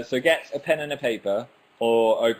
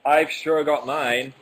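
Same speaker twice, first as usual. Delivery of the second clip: excited announcing; 6 semitones higher, 8 dB louder than usual. Real, not acted. Announcing drawing game.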